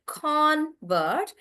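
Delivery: "convert" slow, in two parts, CON stressed; noun stress. Shown as a demonstration. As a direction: spell out "convert" by syllable, stressing the first. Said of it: "we pronounce that as a noun".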